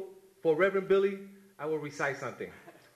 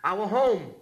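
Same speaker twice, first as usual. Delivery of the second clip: poem recitation; 5 semitones lower than usual. Acted. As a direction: read it like a eulogy for a fallen brother.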